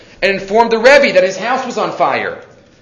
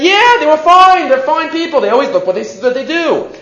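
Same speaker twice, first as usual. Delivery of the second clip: much higher, breezy dismissive assurance; mock naive approval; 10 semitones higher, 3 dB louder than usual. Mimicking yeshiva men who called Germans fine. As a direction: parody the naive optimists.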